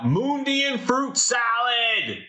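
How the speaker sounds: high, drawn-out shout; yelling. Acted. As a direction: yell it out to the street. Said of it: "Cotton yells out".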